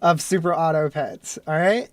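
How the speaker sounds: ordinary talk, nothing marked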